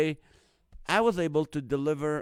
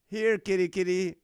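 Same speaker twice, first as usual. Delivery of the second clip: mock cat call; 5 semitones higher, 6 dB louder than usual. Calling a cat, as parrot joke.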